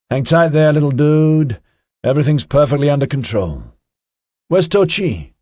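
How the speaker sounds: a hint of a cocky, chatty guy; Ozzy's voice. A narrator's reading. Ozzy reassuring the boy.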